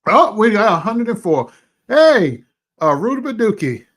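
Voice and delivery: high, loud excited shout. Real, not acted. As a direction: celebrate the count out loud. Reaches a hundred viewers.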